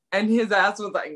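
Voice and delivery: ordinary delivery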